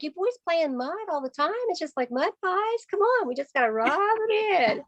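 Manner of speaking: put-on high, playful nudge to get the kids going; coaxing boys. Consultant coaxes the twin boys to rub in the mask, high and playful. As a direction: egg the boys on like it's just mud pies.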